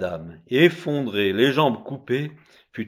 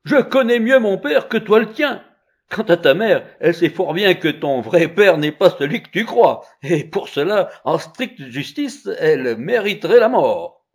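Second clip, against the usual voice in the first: loud, high, indignant retort; heated, high-pitched accusation; angry child; 8 semitones higher, 9 dB louder than usual. A narrator's reading. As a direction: throw it back angrily as a child. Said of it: "L'enfant se mit en colère".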